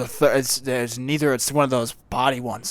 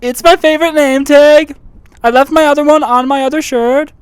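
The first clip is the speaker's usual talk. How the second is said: louder, deadpan gas station clerk; loud clerk character; 12 semitones higher, 13 dB louder than usual. Acted. Clerk Steve brags about his name tag and car, loud and deadpan. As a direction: play the earnest oddball attendant.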